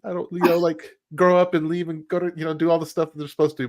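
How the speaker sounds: high, half-joking panicked father; hamming up a dad who can't let his girls grow up; mock-anguished pleading, pitched high; mock distraught dad.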